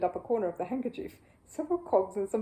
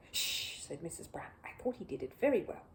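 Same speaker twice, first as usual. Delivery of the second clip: hushing whisper; 3 semitones lower, 8 dB quieter than usual. Acted. Mrs Brown hushing her husband.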